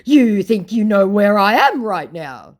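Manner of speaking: high, loud, slow challenge; declaring scammer. Retold or imitated. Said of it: "declares my scammer".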